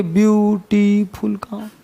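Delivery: Beautiful drawn out slowly, syllable by syllable; syllable counting. Shown as a demonstration. Teacher to class, counting syllables, slow and drawn out. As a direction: stretch beautiful to count its beats. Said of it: "अब कहाँ गिनेंगे?".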